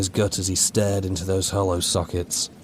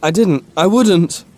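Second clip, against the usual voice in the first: frightened denial; 10 semitones higher, 8 dB louder, slower than usual. A narrator's reading. Terrified soldier denies to creature.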